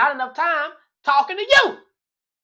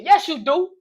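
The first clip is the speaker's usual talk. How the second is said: higher, louder accusing retort; 5 semitones higher, 5 dB louder than usual. Acted. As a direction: call out her lie. Arguing with girlfriend.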